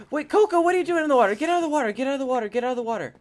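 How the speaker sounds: high, urgent call that eases down; frantic high call that fades with each repeat; calling out to a pet. Acted. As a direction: shout to the pet to get out of the water, then settle.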